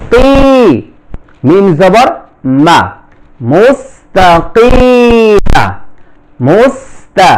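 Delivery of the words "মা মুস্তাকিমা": normal talking voice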